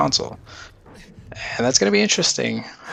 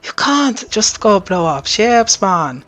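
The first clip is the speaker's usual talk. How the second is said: bad, slow, high Jamaican accent; hammed-up Jamaican accent, drawn out slowly; 9 semitones higher, much slower than usual. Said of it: "Terrible, terrible Jamaican accent".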